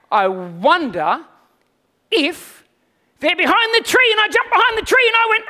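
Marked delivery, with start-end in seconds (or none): 0.1-4.1 slow, drawn-out, higher playful wondering; teasing seek call. Retold or imitated. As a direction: tease the hiding kids, stretching the words. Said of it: "I go".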